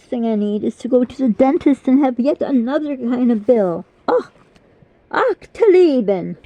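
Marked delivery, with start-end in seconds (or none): 4.1-6.4 imitating uncle's German. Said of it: "My Uncle Günther".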